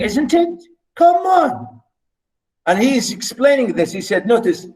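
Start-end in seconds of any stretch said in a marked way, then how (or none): 0.0-1.8 excited exclamation. Real, not acted. Teacher marvels at the poem to students, excited shout. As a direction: burst out in amazement.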